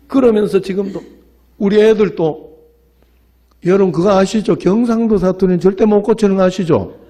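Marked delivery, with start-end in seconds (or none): none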